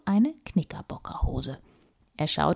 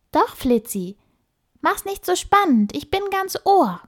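put-on eager young girl voice; 12 semitones higher, 5 dB louder than usual. Emma urges Flitzi to tell.

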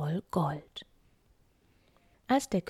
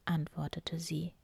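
ordinary delivery

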